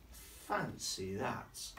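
very slow, drawn-out response; measured, indulgent dad reply; marvelling daddy. Acted. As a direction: stretch each word in mild storybook wonder. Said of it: "Daddy says".

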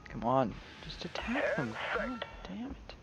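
very soft, almost whispered plea; exasperated under breath. Real, not acted. Gamer begging idle planes to attack, exasperated near-whisper. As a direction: curse them under your breath.

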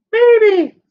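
sudden high, loud teasing maybe; playful squeaky maybe. Pretend. Teacher answering for a colleague in a squeaky voice. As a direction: squeak it like a shy kid.

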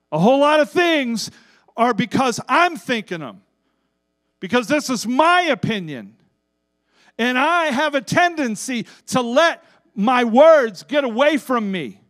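high, loud preaching; fiery preaching. Real, not acted. Preacher confessing to his church at full preaching force. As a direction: drive it home to the congregation.

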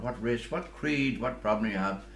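ordinary talk

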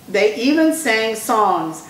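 ordinary delivery, nothing marked

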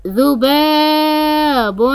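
long held aa in ذباب; ذباب said slowly with a sustained baa; elongated letter. Shown as a demonstration. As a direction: stretch the ا of ذباب right out. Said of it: "حرف الباء ممدود بالألف".

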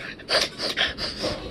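an unvoiced grossed-out exhale; breathy disgusted huff. Cop recoiling from the breath, breathy huff. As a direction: puff out like a bad smell hit you. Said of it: "he's like".

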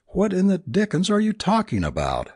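high-pitched, exasperated bewilderment; baffled exclamation. A narrator's reading. Friend questions Tristan's talk of an asset, baffled. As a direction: demand to know, utterly baffled.

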